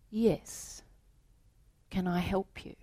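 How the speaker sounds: soft, unbothered whisper; calm hushed manager. Acted. Manager calmly greeting the frantic salesman, hushed. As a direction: ask as if nothing happened.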